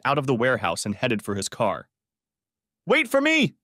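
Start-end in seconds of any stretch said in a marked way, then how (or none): 2.9-3.6 very high, loud cry; shouted. A narrator's reading. Shannon shouting after Darren as he drives off, very high and loud. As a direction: yell it after the fleeing car. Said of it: "she shouted".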